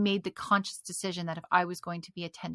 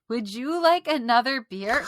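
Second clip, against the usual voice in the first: forced sweet, a bit higher and louder; fake nice voice; 4 semitones higher, 5 dB louder than usual. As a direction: put on an overly polite voice. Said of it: "I had to use the fakest voice ever".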